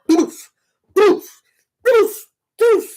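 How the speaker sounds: short loud "doof" thuds; punchy vocal crash noises; imitated impact thuds. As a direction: mimic bodies hit by a car, evenly spaced. Start running everybody over.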